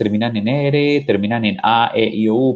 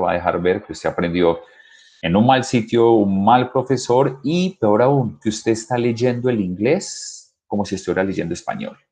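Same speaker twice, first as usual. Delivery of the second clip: ordinary talk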